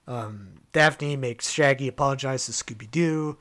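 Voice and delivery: ordinary delivery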